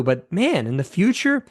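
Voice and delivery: usual speaking voice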